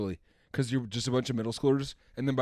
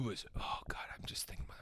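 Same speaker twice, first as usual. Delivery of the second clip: whispering; 2 semitones lower, 16 dB quieter than usual. Real, not acted. Craving the breakfast.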